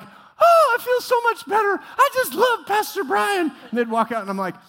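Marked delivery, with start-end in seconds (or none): 0.0-3.5 very high, loud swooning praise; gushing congregant. Retold or imitated. Relieved congregants gushing about Pastor Brian, very high. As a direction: swoon over him like a starstruck fan. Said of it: "they're like".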